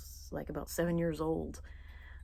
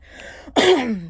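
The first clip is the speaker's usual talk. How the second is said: loud throat clearing; a sharp, loud clearing of the throat; 7 semitones higher, 15 dB louder than usual. Real, not acted. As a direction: clear the frog from your throat. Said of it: "Just can't get that frog out of my throat".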